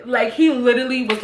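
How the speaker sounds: ordinary delivery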